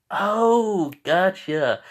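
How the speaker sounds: high, drawn-out note of realisation; sudden understanding. Acted. Reacting to the explanation about the bird, getting it. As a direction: let the penny drop out loud.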